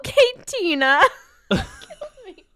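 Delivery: high, loud, laughter-cracked voice; breaking up laughing. Real, not acted. Repeating the Tina joke to co-host, cracking up.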